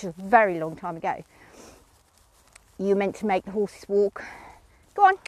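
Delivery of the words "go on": ordinary talk, nothing marked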